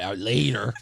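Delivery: friend's sign-off. Retold or imitated. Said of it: "he ends every phone call with".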